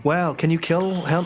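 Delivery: ordinary talk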